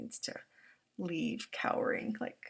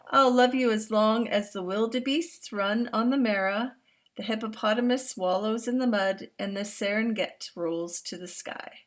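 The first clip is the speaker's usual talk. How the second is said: louder, expressive read-aloud voice; storybook reading to young listeners; reading to children; 3 semitones higher, 8 dB louder than usual. Acted. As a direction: read it out big and warm for the class. Reads picture book aloud.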